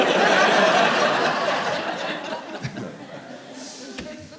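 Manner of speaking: breathy high cackle; real laughing fit; high unvoiced laugh. Real, not acted. Comic laughing at own joke, high airy laugh.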